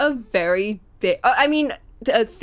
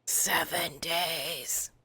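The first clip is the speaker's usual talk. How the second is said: whispering; 5 semitones lower, 11 dB quieter, much slower than usual. Acted. Whispered line.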